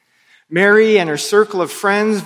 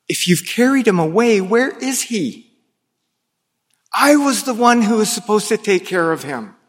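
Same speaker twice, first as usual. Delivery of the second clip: pleading; 5 semitones higher than usual. Retold or imitated. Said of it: "She pleads with compassion and confusion".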